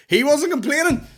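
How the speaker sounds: high loud mock repeat; mock shout. Mocking the ad's punchline, high and loud. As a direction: yell the punchline back.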